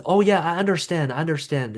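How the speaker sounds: mock-sympathetic, rushed and trailing off; flippant comment. Shown as a demonstration. Coach showing a flippant reply to an upset person, dismissive. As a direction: brush them off with hollow understanding. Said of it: "we don't use a flippant comment".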